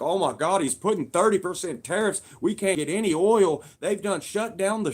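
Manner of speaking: hammed-up alarm over tariffs; mocking panicked people. Complainers mocked over tariffs, loud mock panic. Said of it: "people are like".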